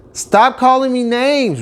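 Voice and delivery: put-on high, slow, hurt childlike cry; hurt shout. Rudolph shouting at the laughing reindeer, high and hurt. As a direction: plead with the bullies. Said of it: "Rudolph shouted".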